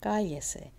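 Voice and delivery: "Cállese" as CA-ye-se; first syllable of "Cállese" strong, the rest falling; stress on CA. Shown as a demonstration. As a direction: lean on "Cá" in "Cállese". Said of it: "Still on the ca".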